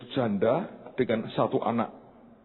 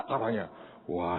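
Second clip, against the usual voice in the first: ordinary delivery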